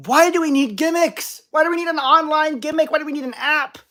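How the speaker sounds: high-pitched, exasperated string of questions; exasperated ranting. Real, not acted. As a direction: rant in disbelief, pitch jumping up high. Rant against online toy gimmicks.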